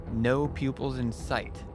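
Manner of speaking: ordinary delivery, nothing marked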